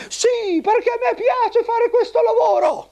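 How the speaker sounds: very high squeaky bellboy puppet; eager little puppet porter, high and squeaky; squeaky shrill puppet voice. Acted. Bellboy answers.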